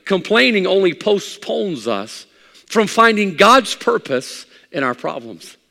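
high, loud pulpit delivery; full-force preaching. Acted. Preacher acting out his sickbed complaints to God, full-force preaching. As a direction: pile up the complaints, then press the lesson home.

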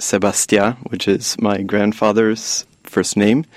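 usual speaking voice

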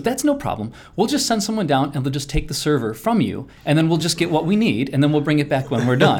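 sweet, high-pitched imitation; mock-sweet official caller; Mary Kate. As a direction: breeze through it sweetly in a lifted voice. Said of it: "she very sweetly said".

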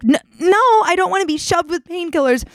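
high-pitched mock protest; retold exasperated refusal. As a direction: act out refusing, pitch jumping up on the no. Retold refusal of more morphine.

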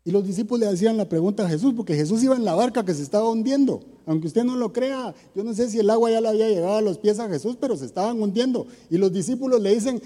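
fast, high, urgent sermon delivery; fervent preaching. Acted. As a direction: press the story forward quickly. Preaching with force.

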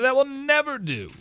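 playful high howl; mock howl. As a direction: keep howling the refusal, high. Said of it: "howled the other two together".

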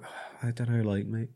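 hesitant, uncertain offer trailing up into a question; sheepish. Retold or imitated. Blacksmith tentatively pricing his own work to a shop owner, sheepish. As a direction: imitate yourself naming a price sheepishly, slowing at the end. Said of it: "Like, really sheepishly".

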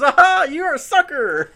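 high, loud mocking taunt; taunting cartoon voice. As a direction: jeer it like a cartoon. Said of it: "dances up and goes".

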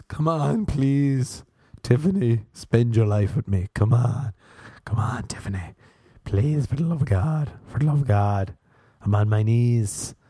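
hammed-up pleading, urgent and insistent; mock begging. Imitating man on his knees proposing to Tiffany, begging. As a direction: plead desperately, playing it for laughs. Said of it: "looks like he's begging too".

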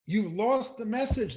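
high, loud sneering mimicry; mock reporters. Mocking the media for saying protesters lost the message, high and loud. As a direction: parrot the media's line with scorn. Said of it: "they say".

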